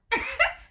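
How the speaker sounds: high squeaky laugh. Real, not acted. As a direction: burst into a giggle. Laughing at own coughing.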